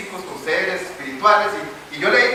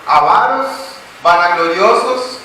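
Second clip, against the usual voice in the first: loud, slow, each word hammered out; preacher pounding out a verse list, loud and slow; thundering scripture reading; 3 semitones higher, 6 dB louder, much slower than usual. Acted. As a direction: denounce every sin on the list. Reads 2 Timothy vice list.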